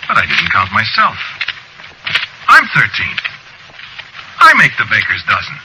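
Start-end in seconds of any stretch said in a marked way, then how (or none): 2.5-5.6 high, loud insistence; indignant protest. Acted. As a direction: pipe up to be counted. Ignored narrator protests being uncounted.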